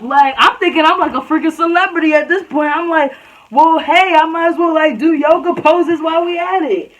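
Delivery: high, loud, hammed-up disbelief; mock-incredulous outburst. Student asks overreacting classmates what's wrong, loud incredulity. She mocks crowd for overreacting.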